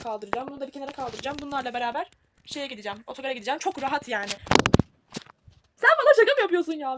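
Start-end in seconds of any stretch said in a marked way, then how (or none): 5.8-7.0 loud, high "are you kidding me"; real outburst after dropping the phone; exasperated outburst. Real, not acted. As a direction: snap at the phone after it falls. The phone fell mid video.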